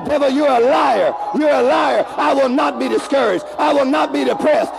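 shouted, high and loud, at the devil; defiant declaration. Shown as a demonstration. Congregation told to shout at the devil, defiant. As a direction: rebuke the devil at full voice.

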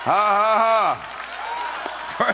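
high, slow, drawn-out "ha ha ha"; exultant laugh. Real, not acted. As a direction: laugh out in triumph, pitched high. Celebrating in the sermon.

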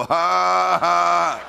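high shouted ha, ha; defiant demonstrated laugh. Shown as a demonstration. Answering the devil about your kids, forced laugh. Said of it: "And you go".

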